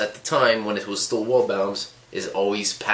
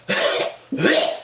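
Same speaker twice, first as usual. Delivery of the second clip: hammed-up hacking and choking; badly faked coughing fit; fake choking cough; 9 semitones higher than usual. Old lady protesting at vapor, fake choking cough. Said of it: "doing this fake choke thing".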